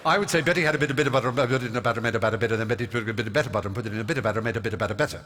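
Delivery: gabbled tongue twister at double speed; rapid-fire. Retold or imitated. Hyper young pupil rattling off the tongue twister to coach, very fast. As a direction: race through it without a breath. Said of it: "Slow down, dear".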